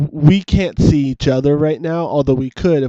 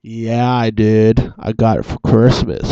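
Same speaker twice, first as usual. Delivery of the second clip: normal talking voice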